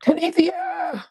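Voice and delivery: drawn-out high call of "Tunisia!"; calling out name. Retold or imitated. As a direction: call "Tunisia!" slowly and high, like someone yelling across the house. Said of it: "That's what Kay say".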